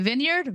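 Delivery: questioning "vineyard" as VIN-yerd, rising; short-i vineyard. Real, not acted. Reader unsure how to say it, "vineyard" as VIN-yerd. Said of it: "I don't know".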